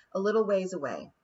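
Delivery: plain reading voice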